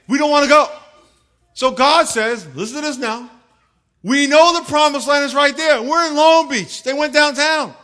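high, loud excited preaching. Acted. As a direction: belt it out to the congregation. Acting out grumbling Israelites.

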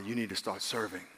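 hushed, low inner prompting; Holy Spirit's voice. Acted. Holy Spirit prompting a Bible reader, low and soft. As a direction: drop low and soft, a quiet nudge. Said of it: "What does the Holy Spirit sound like".

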